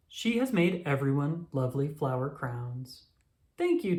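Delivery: ordinary delivery, nothing marked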